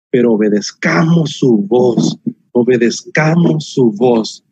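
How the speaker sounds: slow, low earnest urging; slow solemn plea. Real, not acted. Pastor urging the young to obey God, slow solemn plea. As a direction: let each word land slowly.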